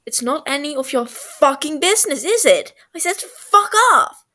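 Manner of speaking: loud, building to a yell; furious yell, driving him away; furious shout. Acted. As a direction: blow up and scream fuck off. Tommy explodes at Wilbur.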